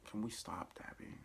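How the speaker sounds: soft unvoiced whisper. Real, not acted. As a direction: breathe it out as a tired aside. Her weary aside to the show.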